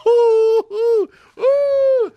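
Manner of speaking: very high, loud excited ooh; delighted high-pitched hoots; gleeful hoots. Real, not acted. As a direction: whoop with glee at the thought. Gleeful at Patriots losing.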